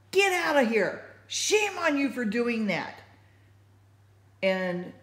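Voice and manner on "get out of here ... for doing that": high, loud scolding that eases off; retold yell. Shouting at the men beating the homeless man, loud scolding. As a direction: drive them off with a raised voice. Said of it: "I was even more stronger than I'm sounding".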